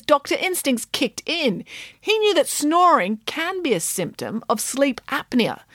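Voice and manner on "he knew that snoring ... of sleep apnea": usual speaking voice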